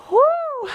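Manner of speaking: high "woo" on an exhale; effort woo. Real, not acted. Instructor puffing through her workout, high effort woo. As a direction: blow out a high, airy woo. Recovers after the set.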